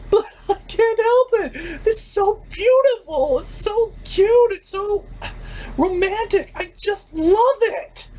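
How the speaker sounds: high, slow laughing sob; half laugh, half cry over how beautiful it is; happy crying. Real, not acted. Reviewer crying happily over a romance, high and slow.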